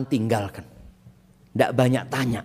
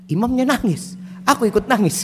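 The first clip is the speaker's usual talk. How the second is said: 1 quoted friend; 9 semitones higher than usual. Retold or imitated. Friend explains his crying.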